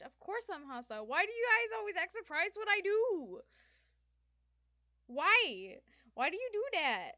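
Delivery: loud, high indignant outburst; angry. Acted. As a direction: snap back at them, loud and high. Angry retort at chat.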